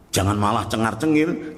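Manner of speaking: ordinary delivery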